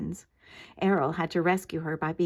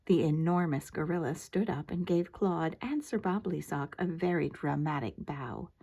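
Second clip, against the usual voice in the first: ordinary delivery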